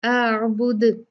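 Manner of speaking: आबुद slow, the final द bounced; qalqala. Shown as a demonstration. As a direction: let the द in आबुद echo. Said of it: "कलकला का rule apply करेंगे". (आबुद में कलकला)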